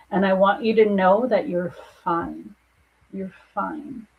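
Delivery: ordinary talk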